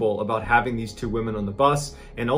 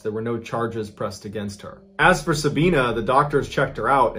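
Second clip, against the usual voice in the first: ordinary delivery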